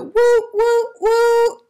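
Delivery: very high, loud whistle hoots; train whistle. Acted. The train blowing its whistle as it leaves, loud. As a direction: hoot like a steam train.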